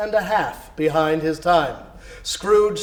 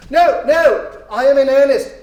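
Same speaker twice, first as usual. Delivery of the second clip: much higher, loud insisting; eager insistence; 8 semitones higher, 8 dB louder than usual. Acted. Scrooge insisting to the boy, loud and high. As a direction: insist with excited earnestness.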